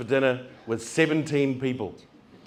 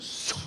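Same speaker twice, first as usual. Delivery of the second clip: door swoosh; 12 dB quieter than usual. Retold or imitated. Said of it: "the doors just went".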